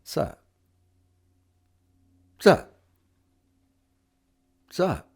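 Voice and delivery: quick offhand Sup with a nod; a cool guy greeting a friend with Sup; laid-back Sup. Shown as a demonstration. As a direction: toss a lazy Sup. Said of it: "Some people might say".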